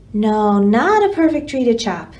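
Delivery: usual speaking voice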